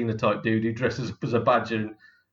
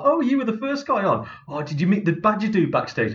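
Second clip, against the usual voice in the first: audience member; 7 semitones higher than usual. Retold or imitated. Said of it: "come off stage and like".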